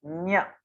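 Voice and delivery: ञ sounded at the palate through the nose; nasal letter demo. Shown as a demonstration. Teacher showing students palatal nasal ञ. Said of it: "तालु और नासिका दोनों के प्रयोग से होगा".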